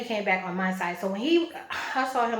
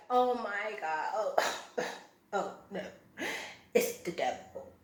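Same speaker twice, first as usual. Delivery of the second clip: hushed, slow mock dread; soft, half-whispered imitation of alarm; mock horror; 6 dB quieter, slower than usual. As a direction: imitate a horrified woman under your breath. Imitating woman's horrified reaction.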